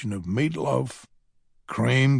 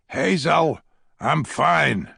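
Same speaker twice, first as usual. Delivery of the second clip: insisting; 4 semitones higher, 7 dB louder, slower than usual. A narrator's reading. Insisting over alarmed nurses.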